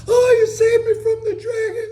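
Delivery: very high and loud swooning thanks; wife's gushing falsetto. Retold or imitated. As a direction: play the rescued damsel, hammed up. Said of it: "and she".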